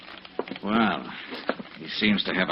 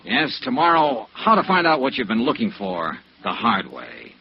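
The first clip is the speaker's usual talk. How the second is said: drawn out, lifted sign-off; radio sign-off; 4 semitones higher, 3 dB louder than usual. Acted. Detective signs off to listeners, lifted sign-off. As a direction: invite listeners back.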